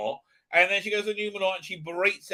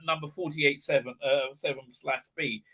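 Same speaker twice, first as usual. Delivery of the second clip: ordinary delivery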